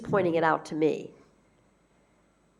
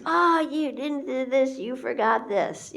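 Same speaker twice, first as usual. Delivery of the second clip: higher, slow, complaining wife; wife listing what he forgot; nagging wife; 6 semitones higher than usual. Retold or imitated. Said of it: "your wife says".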